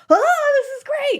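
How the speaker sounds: high, slow gushing delight; mock delight. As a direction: gush over it like a new craze. Mock excited quote.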